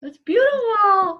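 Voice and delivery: high, drawn-out, moved praise; real gushing admiration. Real, not acted. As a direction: stretch it out slowly with delight. Listener gushes over the story.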